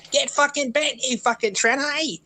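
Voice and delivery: very high-pitched imitation of someone yelling; mock yell. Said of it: "he was just yelling".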